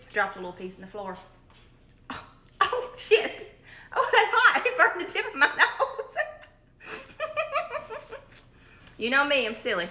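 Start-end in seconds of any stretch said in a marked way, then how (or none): 2.1-3.6 startled yelp. Real, not acted. Burned nose on hot cobbler. 4.0-6.0 high, laughing through the pain. Real, not acted. Laughing after burning her nose. 6.8-8.2 high squealing laugh. Real, not acted. Laughing after burning her nose.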